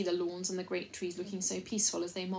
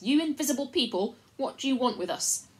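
loud formal demand, pitched up at the start; louder voice; 3 semitones higher, 7 dB louder than usual. A narrator's reading. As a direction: address an unseen crowd. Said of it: "added in a louder voice".

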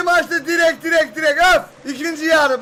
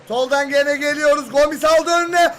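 ordinary talk

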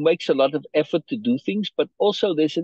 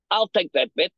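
false bravado; 7 semitones higher than usual. Acted. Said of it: "I said a little bit too loudly, like I was saying it with false bravado".

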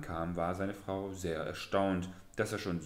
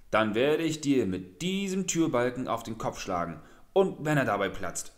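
angry scolding; 3 semitones higher, 6 dB louder than usual. Acted. Wife threatens Pak Dungu with the door beam, loud angry scolding. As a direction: chew him out, threatening. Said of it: "wurde seine Frau noch zorniger".

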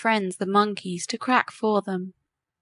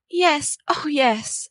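overjoyed; 5 semitones higher, much slower than usual. A narrator's reading. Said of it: "from sheer joy she burst into tears".

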